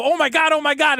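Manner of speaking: mock alarm. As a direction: play the staff startled into action. Staff reacting to diarrhea claim.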